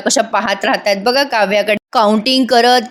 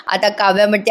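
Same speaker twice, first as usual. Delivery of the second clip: usual speaking voice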